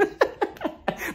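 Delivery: quiet breathy laugh; short giggle. Real, not acted. Laughing at Courtney.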